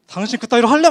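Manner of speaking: playful fake shout at a wife; mock yelling spouse. Shown as a demonstration. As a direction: bark it like a loud quarrel you don't actually have. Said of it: "막 이렇게 티나게 막 소리 지르는 게 아니라".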